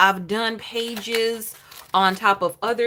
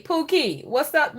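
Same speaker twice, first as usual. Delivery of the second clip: normal talking voice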